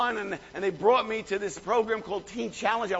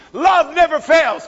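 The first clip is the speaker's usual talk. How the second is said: much higher and louder, slow, every word hammered; shouted proclamation; 7 semitones higher, 11 dB louder, slower than usual. Acted. Preacher crowning the scripture for the congregation, shouted slow proclamation. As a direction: cry it out as the climax.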